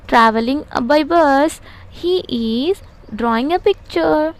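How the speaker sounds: slow dictation. Shown as a demonstration. Teacher dictating examples slowly.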